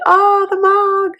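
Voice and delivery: high, slow, drawn-out sarcasm; hammed-up deadpan squeal; mock unimpressed Amira. Amira reacting to the mug, high slow mock voice. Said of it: "She's like".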